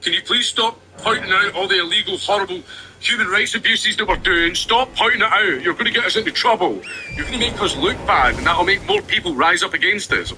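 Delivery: high mock whine of a government caught out. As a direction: squeak it out like a cornered official begging critics to shut up. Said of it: "that's what they mean by misinformation".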